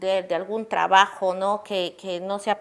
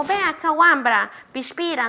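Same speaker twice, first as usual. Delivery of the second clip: higher, teasing call with a nickname; mocking teacher; 6 semitones higher than usual. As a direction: jeer at the child from across the room. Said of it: "no podemos tratar así".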